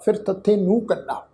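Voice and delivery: usual speaking voice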